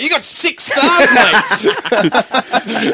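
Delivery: high, slow, hammed-up mate voice; mock bloke. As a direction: drawl it like a chummy bloke, teasing. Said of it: "He's like".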